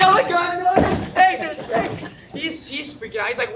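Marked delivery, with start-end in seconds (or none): 0.0-1.9 high, loud mock panic; panicked shriek. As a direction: squeal it like you're terrified of the snake. Naked friend panics over snake.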